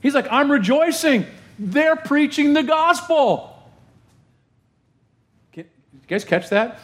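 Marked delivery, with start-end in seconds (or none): 0.3-3.6 beam it out as overjoyed Paul; joyful Paul. Retold or imitated. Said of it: "he's not ticked off".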